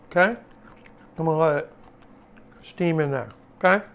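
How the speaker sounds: talking with mouth full; words around a bite of food. Real, not acted. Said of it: "Sorry to talk with my mouth full".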